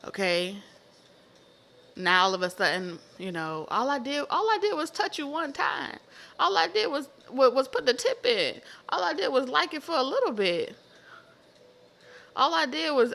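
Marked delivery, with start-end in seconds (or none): 3.7-13.1 whining excuses, pitched much higher; high whiny excuses. Retold or imitated. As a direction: plead innocence in a high whine. Acting the guilty one's excuses.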